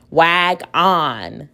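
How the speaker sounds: "wag on" as two separate words, "on" said like "ahn"; "wag on", both syllables given full weight; full vowel in second syllable. Shown as a demonstration. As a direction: sound out "wag on" with a full short o. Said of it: "That sounds kinda silly".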